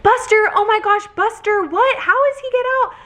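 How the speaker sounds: high-pitched imitation of a panicking dog owner; freaking out. Old man learns his dog was found, imitated freaking out. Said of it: "immediately just starts freaking out".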